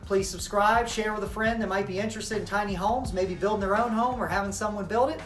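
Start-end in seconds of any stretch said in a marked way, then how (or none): none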